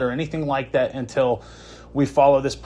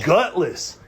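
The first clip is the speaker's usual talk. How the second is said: ordinary talk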